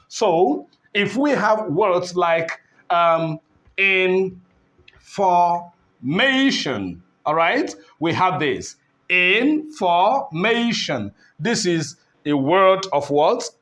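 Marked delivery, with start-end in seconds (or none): 3.8-7.0 "information" very slowly, in, for, mation, with long gaps; teacher slowly breaking up "information" for learners; syllable by syllable. Shown as a demonstration. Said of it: "three syllables". 9.1-11.1 "information" slowly in pieces, in, for, mation; slow teaching breakdown of "information"; syllable by syllable. Shown as a demonstration. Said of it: "three syllables".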